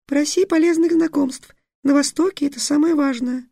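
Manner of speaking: very high, small muffled fairy; tiny stifled fairy from under a cloak. Acted. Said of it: "тихий, придушенный голос феи".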